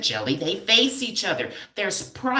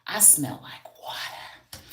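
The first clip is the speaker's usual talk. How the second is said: whispered aside; 10 dB quieter than usual. Shown as a demonstration. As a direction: say "I smell like water" as a secret whisper.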